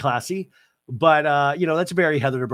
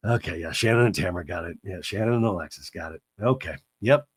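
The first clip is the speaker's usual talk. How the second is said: ordinary delivery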